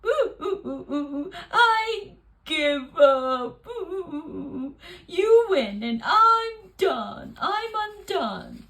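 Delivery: loud, slow mock sobbing; hammed-up weeping. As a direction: wail like a defeated bear. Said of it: "Bear fell to the floor and wept".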